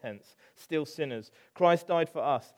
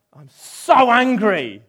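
louder, slow, dragged-out grumble; slow mock fury; 6 semitones higher, 12 dB louder, slower than usual. Jonah sulking over the repentant town, slow mock fury. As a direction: stretch it out like a sulky prophet. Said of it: "he's like".

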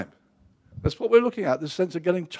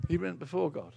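soft repeat; 11 dB quieter than usual. Acted. Softer repeat of the line.

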